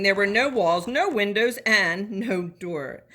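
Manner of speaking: plain reading voice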